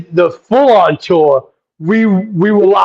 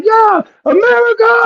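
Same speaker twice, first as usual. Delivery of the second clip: very high imitated crowd scream; high-pitched mock fangirl cheer; mock fan screaming; 13 semitones higher than usual. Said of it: "And they're screaming".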